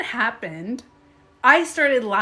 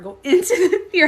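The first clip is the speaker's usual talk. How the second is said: higher, louder, laughing through go into the fear; laughing self-coaching; 8 semitones higher, 8 dB louder, faster than usual. Retold or imitated. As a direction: push yourself on while giggling.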